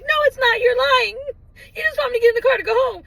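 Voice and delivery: high, loud mock accusation; frantic re-enacted protest, rushing at the end; upset disbelief. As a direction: argue back in hammed-up distress. Re-enacting accusing the officer of lying.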